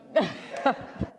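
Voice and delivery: airy startled laugh; breathless surprised laugh. Real, not acted. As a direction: laugh out in disbelief. Laugh after being surprised.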